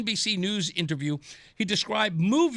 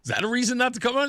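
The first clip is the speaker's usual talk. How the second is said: ordinary talk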